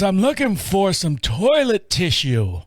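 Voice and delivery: put-on slow, mild old man voice; a hint of a frail old man; lightly voiced old man. Answering in the Walmart aisle, a lightly voiced old man. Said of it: "He goes".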